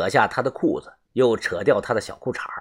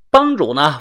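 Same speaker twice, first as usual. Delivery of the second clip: ordinary delivery, nothing marked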